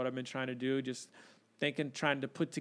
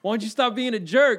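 higher, louder dad scolding; stern shout; 8 semitones higher, 10 dB louder than usual. Retold or imitated. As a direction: bark it like a fed-up father. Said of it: "talking about this moment".

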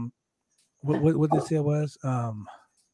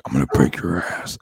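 deep gravel Batman threat, a lot lower; Ben Affleck as Batman; 12 semitones lower, 3 dB louder than usual. Retold or imitated. Host imagines Ben Affleck as Batman threatening, deep growl. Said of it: "if Ben Affleck was like".

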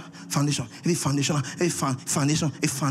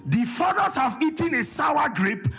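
preaching; 7 semitones higher than usual. Acted. Preacher reads scripture aloud.

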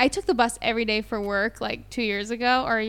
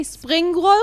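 higher Israeli-accented "spring roll"; 5 semitones higher, 4 dB louder than usual. Shown as a demonstration. Host testing English words on co-host, Israeli accent. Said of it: "do you say it with an Israeli accent".

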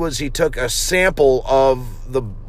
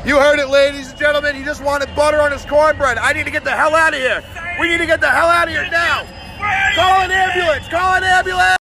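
high, far-off field reporter voice; news reporter parody; 14 semitones higher than usual. Acted. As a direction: play a frantic TV reporter. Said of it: "I'm Vaj Michelson, Channel One News".

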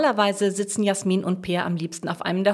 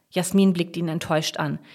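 usual speaking voice

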